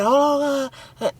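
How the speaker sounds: choked watery cry; imitating someone drowning, gargling a scream; gargled scream. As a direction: scream as if through a mouthful of water. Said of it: "they got water full in their mouth".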